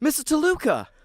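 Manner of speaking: startled cry of the name; startled call. Acted. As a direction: caught off guard, blurt it out.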